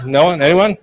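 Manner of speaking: ordinary delivery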